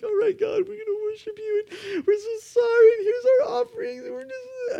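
high, far-off fake weeping, whiny and breaking; mock crocodile tears. Preacher mocking insincere worshippers with fake crying. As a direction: ham up an insincere sob to God. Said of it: "here's the people with crocodile tears".

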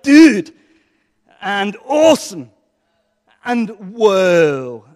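surfer dude. Shown as a demonstration. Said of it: "my best surfer impression".

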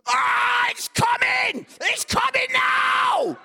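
high, very loud mock screams; hammed-up child acting out childbirth; over-the-top labour screaming. Girl overacts Mary giving birth.

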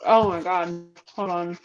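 talking while brushing teeth; mouth full. Real, not acted. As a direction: toothbrush in mouth. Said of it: "I'm brushing my teeth".

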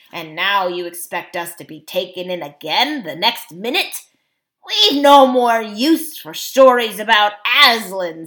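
loud jeering dwarf leader; mock them at full voice; indignant dwarf, building to derision; scornful dwarf. A narrator's reading.

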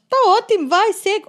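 loud, high thrilled reply. Retold or imitated. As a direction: accept with glee. Retold thrilled agreement.

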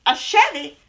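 ordinary talk, nothing marked

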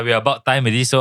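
ordinary talk, nothing marked

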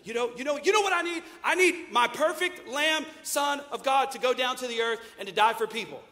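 a petulant God demanding his lamb; preacher's parody of a vengeful God; mock angry God.

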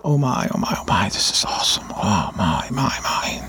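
imitating a man crying, voice sinking low; retold tearful murmur of wonder; tearful awe. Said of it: "he's patting his chest and he's crying".

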